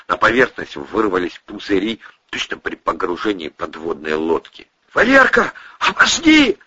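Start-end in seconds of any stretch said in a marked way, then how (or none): 4.9-6.6 high, strained wheezing cry. Acted. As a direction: rasp out a plea. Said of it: "захрипел Андрей".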